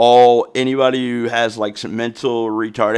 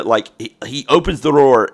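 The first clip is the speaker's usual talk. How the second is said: usual speaking voice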